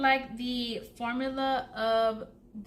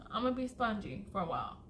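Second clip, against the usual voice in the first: ordinary delivery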